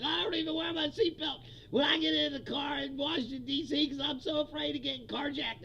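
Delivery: mock frightened whine of a politician; Mark Wayne Mullin. Mimicking a senator afraid in Washington, mock panic. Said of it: "Mark Wayne Mullin was like".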